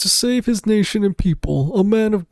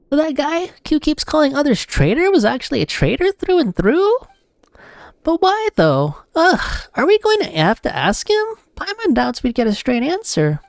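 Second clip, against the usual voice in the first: high, perky little sidekick voice; chirpy fairy companion; high cute companion; 8 semitones higher than usual. Acted. Companion Paimon chimes in.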